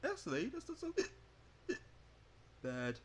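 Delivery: laughing. Acted. Laughing at the failed scare.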